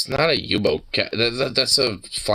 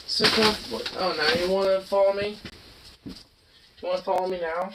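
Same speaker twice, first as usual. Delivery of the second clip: talking to a dog; 11 semitones higher, 5 dB quieter than usual. Real, not acted. High soft coo to pet.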